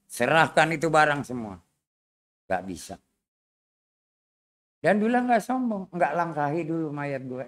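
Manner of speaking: ordinary delivery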